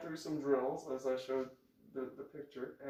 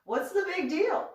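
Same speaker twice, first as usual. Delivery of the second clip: high, loud protest; indignant challenge; 13 semitones higher, 6 dB louder than usual. Retold or imitated. Challenging his shock at country music, indignant. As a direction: demand it, pitched well up.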